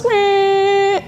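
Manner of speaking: high, sustained comic "meh"; imitated mirror noise. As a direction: hold one high droning note as a pop-up sound effect. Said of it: "it goes".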